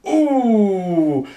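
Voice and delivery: high, loud ooh of alarm; a startled ooh at the crash; rising, loud ooh of alarm; mock shocked ooh. Car crash seen.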